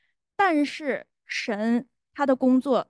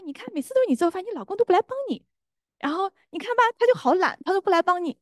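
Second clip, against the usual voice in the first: higher, faster needling whisper of complaint; tempting inner voice; 9 semitones higher, faster than usual. Retold or imitated. An inner voice stirring a wife's resentment, high and rushed. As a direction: egg her on against her husband, high and quick. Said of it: "那个声音在跟我说".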